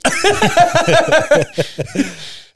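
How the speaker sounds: a big high burst of laughter; cracking up mid-story; loud laugh. Real, not acted.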